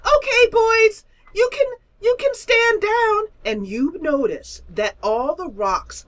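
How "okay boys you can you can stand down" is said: squeaky boss ordering troops; chirpy high commander; high creature command. Acted. Creature commands rock trolls.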